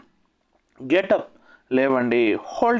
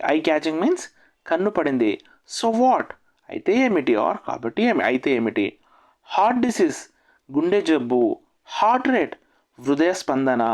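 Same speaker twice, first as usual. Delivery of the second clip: ordinary talk, nothing marked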